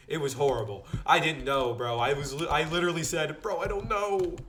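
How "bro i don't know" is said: high, panicky voice; scared. Shown as a demonstration. Student answering the instructor in mock panic. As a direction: blurt it out scared. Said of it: "I didn't say it like that".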